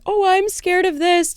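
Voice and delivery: mock whiny high voice; mocking attention seeker. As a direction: parody someone faking fear for attention. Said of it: "people that use, like".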